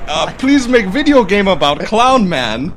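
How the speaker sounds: loud, slow broken-English boss. Retold or imitated. He pushes the boss voice as friends groan, slow and loud. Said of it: "You are the worst".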